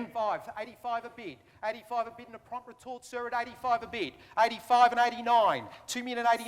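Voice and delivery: brisk auction patter; auctioneer calling. Real, not acted. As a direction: rattle off bids like an auctioneer.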